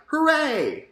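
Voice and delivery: bright, high, loud cheer; excited exclamation. Shown as a demonstration. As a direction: cheer it like real good news. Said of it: "obviously an exclamation".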